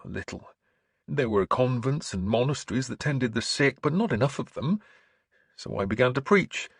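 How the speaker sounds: sincere preacher recounting what he saw; earnest bishop. Acted. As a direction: testify with lifted, heartfelt insistence.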